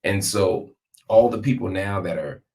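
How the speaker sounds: ordinary talk, nothing marked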